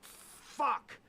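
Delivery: sudden high-pitched alarmed curse; dismayed curse. Retold or imitated. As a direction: blurt it out as the panic hits. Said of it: "And I'm like".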